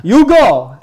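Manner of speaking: loud, high-pitched imitation of an Italian agent; thick Italian accent. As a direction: belt it out in a mock Italian accent. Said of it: "she has this really thick Italian accent".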